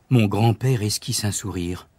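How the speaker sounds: ordinary delivery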